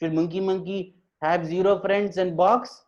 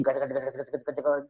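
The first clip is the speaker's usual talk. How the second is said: quieter rapid "kacha-kacha" babble; imitated animal chatter; 3 semitones lower, 5 dB quieter, much faster than usual. Animals gossiping at their meeting, chattering. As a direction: chatter softly like a crowd of animals.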